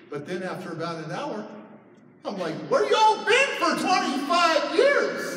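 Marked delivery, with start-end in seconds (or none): 2.7-5.4 high, loud mock-indignant yell; comic bellowed complaint; indignant outburst. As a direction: demand it loudly, drawing it out. Acted outburst at lost family.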